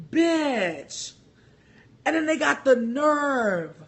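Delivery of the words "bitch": incredulous bitch. Retold or imitated. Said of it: "and I was like".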